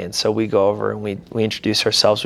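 ordinary delivery

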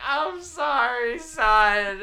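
high, slow mock-weepy mother; hammed-up sobbing mom comforting her son; mom sorry. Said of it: "And she's like".

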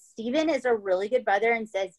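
normal talking voice

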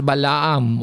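Balaam as "ba-la-AM", three syllables; local pronunciation. Shown as a demonstration. Preacher giving the version "for us", local pronunciation. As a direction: sound both a's in Balaam, "ba-la-am". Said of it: "For us, Balaam".

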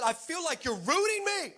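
high-pitched mock shout at God; retold shouting. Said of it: "I'm shouting at him".

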